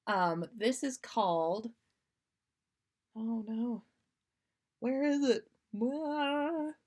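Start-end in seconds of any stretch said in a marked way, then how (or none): none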